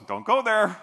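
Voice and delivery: mock alarm from your own head; inner warning voice. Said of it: "that voice that goes".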